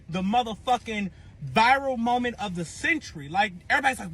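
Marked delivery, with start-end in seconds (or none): none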